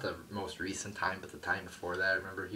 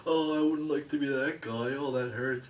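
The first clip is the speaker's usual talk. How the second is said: high, loud mock commentator gush; mock JBL commentary; 7 semitones higher, 8 dB louder than usual. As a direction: ham up the announcer's fake sympathy. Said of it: "J B L's like".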